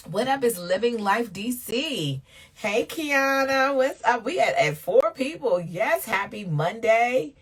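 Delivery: loud, slow, high hello to a friend; excited greeting. Real, not acted. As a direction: call out a delighted welcome. Greeting viewers joining the live.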